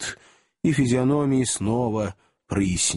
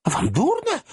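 alarmed. Acted. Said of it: "встревожилась Рэйчел".

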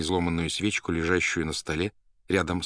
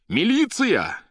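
very high, loud cry; shouting; 7 dB louder, much slower than usual. A narrator's reading. Poet calls the police on the phone, shouting. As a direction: call out urgently at full voice. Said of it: "закричал Иван в трубку".

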